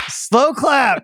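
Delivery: high, hammed-up call of slow clap; dramatic slow clap. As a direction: announce it like the big movie moment. Mocking the movie's slow clap.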